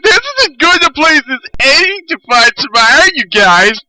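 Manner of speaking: mock cheery self-quote. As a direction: play your own clueless self, bright and pleased. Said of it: "and I'm like".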